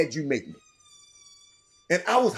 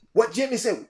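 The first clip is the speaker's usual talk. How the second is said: ordinary delivery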